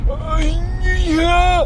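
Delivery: nervous high-pitched fake casual; awkward high reply. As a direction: play it cool in a high strained voice. Awkward reply while hiding from enemies.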